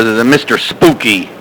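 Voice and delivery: normal talking voice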